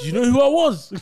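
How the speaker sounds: loud, high hammed-up boast; hammed-up angry star yelling; mock AJ shout. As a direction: bellow it like an outraged celebrity. Friends mimic AJ's outburst.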